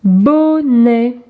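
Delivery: "Bonnet" in two long syllables; drawn-out teacher's demonstration. Shown as a demonstration. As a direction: stretch each syllable for young readers. Exercise word for young readers.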